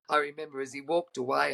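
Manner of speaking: ordinary talk, nothing marked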